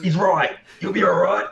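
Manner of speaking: high, loud encouragement; urgent reassurance. Acted. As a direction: reassure the wounded man at full voice. Player acts anxious reassurance.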